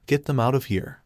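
ordinary delivery

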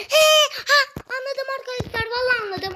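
high-pitched eureka moment; excited "I've got it" outburst; sudden excited realisation. Real, not acted. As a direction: burst out high, then ease down. Suddenly solves the puzzle.

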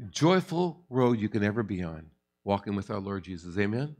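normal talking voice

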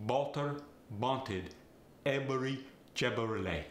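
Walter wanted every Chevrolet said Balter banted ebery Chebrolet; Farsi accent. Shown as a demonstration. Coach demonstrating a Farsi accent, Balter banted ebery Chebrolet. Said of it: "The W and the V get the B sound".

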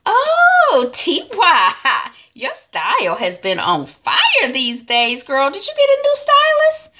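high, loud, drawn-out diva gushing; gushing greeting. Retold or imitated. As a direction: fawn over her, squealing the big words. Hadisa gushes entering the studio.

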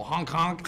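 playful imitated double honk; mock honking. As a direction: honk it like a silly squeeze horn. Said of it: "I'd go".

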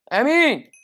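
high, loud chanted amen; mock-pious loud amen; hammed-up amen. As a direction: belt the amen, rising each time. Mock prayer against devils.

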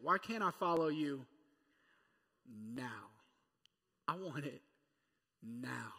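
impatient whine for it now; insistent, clipped demand with a dropping now. Shown as a demonstration. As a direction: press it like an impatient child. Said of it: "Come on, somebody say it".

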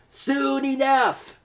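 high, slow crew chorus; drawn-out pirate crew reply; crew echoing. Acted. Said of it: "the crew replied".

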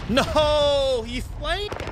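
high, loud cry of disbelief; shocked. Real, not acted. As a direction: caught completely off guard. Enemy flanks him suddenly.

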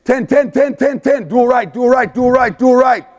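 high, loud repeated play call; yelling the call. Retold or imitated. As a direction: shout it across the field. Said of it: "he'll yell".

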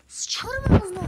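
loud, high burst of swearing; angry cursing. Real, not acted. Frustrated gamer swearing at the game, angry shout. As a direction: curse out loud in real frustration.

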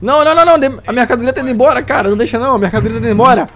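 high, fast panicked protest. Acted. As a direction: panic as the goods leave. Goods escaping with police.

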